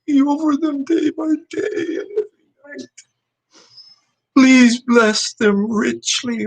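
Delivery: high, choked-up prayer through tears; tearful prayer. Real, not acted. Man praying for his church family, voice breaking. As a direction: pray while almost crying. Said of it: "I apologize for being emotional".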